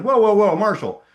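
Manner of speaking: high, loud startled whoa; a boss halting him mid-pitch, startled; mock alarmed stop. Sales leader stopping Marshall, loud surprised whoa. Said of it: "except he said it in an Italian accent that I won't impersonate".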